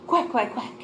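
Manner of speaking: three quick quacks; duck quacking. Shown as a demonstration. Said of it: "a duck goes".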